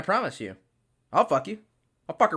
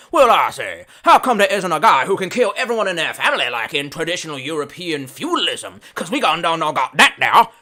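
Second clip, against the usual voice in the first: pompous European; 9 dB louder than usual. Retold or imitated. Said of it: "And just like".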